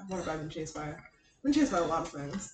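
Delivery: low, not projecting. Real, not acted. Recalling being chased to co-hosts, low and unprojected. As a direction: talk quietly to yourself, low. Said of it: "you have to project".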